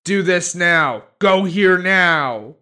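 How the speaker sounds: loud, rude, bossy commands; Revenant. Retold or imitated. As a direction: bark the orders rudely. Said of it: "is extremely rude".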